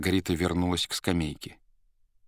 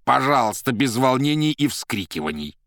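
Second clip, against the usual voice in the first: louder stern scolding; frowning rebuke; 6 semitones higher, 6 dB louder than usual. A narrator's reading. Азазелло одёргивает Маргариту, строго. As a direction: tell her off sternly. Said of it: "нахмурясь, сказал Азазелло".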